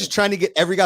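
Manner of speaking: ordinary delivery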